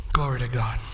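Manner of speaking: breathy hushed aside; soft whispered glory to God; whispered praise. Real, not acted. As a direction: whisper it to yourself in awe.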